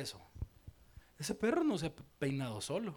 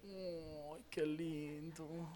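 cooing; 11 dB quieter, much slower than usual. Real, not acted. Cooing at cute photos.

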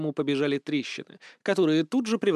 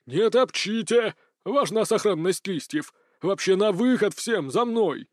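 higher, urgent acted shouting; yell; 4 semitones higher than usual. A narrator's reading. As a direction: order everyone to stop. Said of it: "были остановлены воплем Бурхиса".

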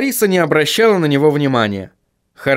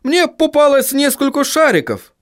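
high, strained dwarf voice; dwarf character; 12 semitones higher than usual. Acted. Dwarf reports what he found.

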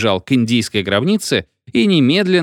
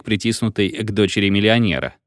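plain reading voice

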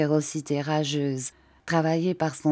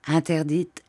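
usual speaking voice

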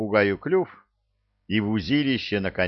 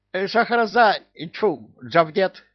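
high, squawky self-correction; blurt, then correct it crossly; flustered parrot; 9 semitones higher, 3 dB louder than usual. Acted. Parrot answering Кевин again, high and flustered.

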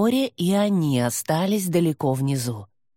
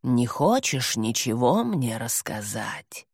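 low, slow and hoarse demon; 6 semitones lower, 4 dB quieter than usual. Acted. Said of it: "хрипло спросил".

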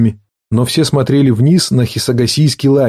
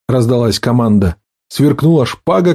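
usual speaking voice

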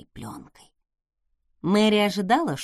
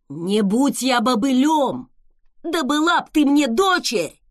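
put-on angry old man shout; 3 semitones higher, 7 dB louder than usual. A narrator's reading. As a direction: bellow like a cranky gardener. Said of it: "крикнул он".

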